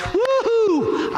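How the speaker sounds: high squealed woo-hoo, mock excited; mock cheer. Paul begging God to take the thorn, a mock high cheer. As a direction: squeal it like an eager kid asking a favour. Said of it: "Paul said".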